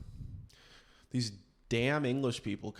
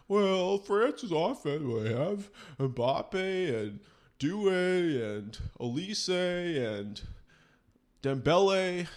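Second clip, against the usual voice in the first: higher, slow pundit voice savouring each name; mock pundit; 7 semitones higher, 5 dB louder, slower than usual. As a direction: list the stars like a smug pundit. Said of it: "It's like".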